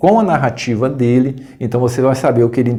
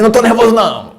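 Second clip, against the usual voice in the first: mock irritated denial; 8 semitones higher, faster than usual. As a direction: blurt it out, protesting too much. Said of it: "Às vezes a pessoa fala assim".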